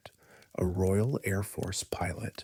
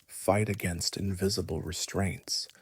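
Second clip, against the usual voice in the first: ordinary delivery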